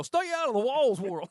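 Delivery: shouted high and loud; yelling. Retold or imitated. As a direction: bellow it out at the world. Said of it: "and go".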